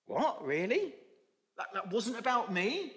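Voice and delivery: a donkey let down by the news; disappointed donkey. Acted. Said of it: "very disappointed".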